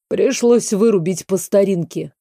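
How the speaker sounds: ordinary delivery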